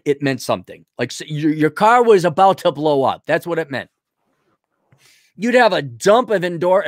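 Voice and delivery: ordinary talk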